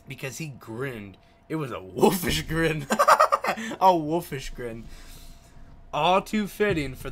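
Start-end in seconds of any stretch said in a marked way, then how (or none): 1.5-2.9 reading through real laughter; giggling as the words come out; laughing. Real, not acted. Gamer cracking up while reading the novel, laughing. 2.9-3.8 sudden high burst of laughter; loud laugh. Real, not acted. Player reading a visual novel, bursting out laughing. 3.8-4.8 reading through real laughter; giggling as the words come out; laughing. Real, not acted. Gamer cracking up while reading the novel, laughing.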